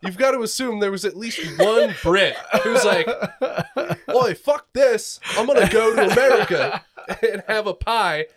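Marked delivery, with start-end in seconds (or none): 4.1-8.3 hammed-up bad British accent; terrible British accent. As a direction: play an indignant Brit with a badly faked accent. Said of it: "my terrible British accent".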